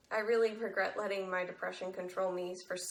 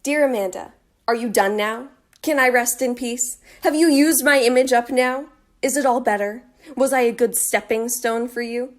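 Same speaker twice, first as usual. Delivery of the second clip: much louder, bitter accusation; bitter angry outburst; 4 semitones higher, 16 dB louder than usual. Acted. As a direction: lash out at the girl who used her. Dead girl bitterly confronts user.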